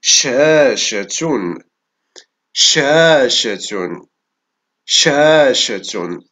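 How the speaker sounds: شاشة drawn out very slowly, both sh sounds held; slow dictation. Shown as a demonstration. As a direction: stretch شاشة slowly and clearly. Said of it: "إذن في هذه الكلمة حرف الشين".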